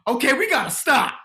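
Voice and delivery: high, loud, urgent plea; imitating Leslie. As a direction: call the stop urgently. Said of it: "she said".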